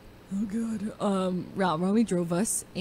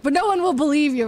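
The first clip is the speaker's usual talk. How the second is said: loud, raised-pitch mock protest; defensive protest; 7 semitones higher, 9 dB louder than usual. Friend denying he cried on stream, loud defensive protest.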